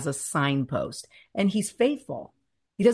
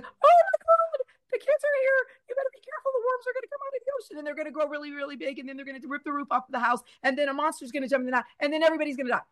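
much higher, frantic hysterical worrier voice; Meryl Streep character; 10 semitones higher, much faster than usual. Retold or imitated. Wife imitating Meryl Streep's doom-saying character, high hysterical. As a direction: squeal it like a panicked aunt. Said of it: "the Meryl Streep character from Lemony Snicket's".

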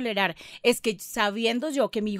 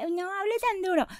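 mock complaint, higher and softer; imitating complaint; 5 semitones higher, 6 dB quieter than usual. Mother quotes her child scolding her volume, mock soft complaint. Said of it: "viene y me dice".

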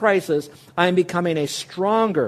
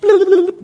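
short laugh; 11 dB louder than usual. Real, not acted. Laughing at childhood memory.

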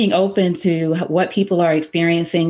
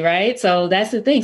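normal talking voice

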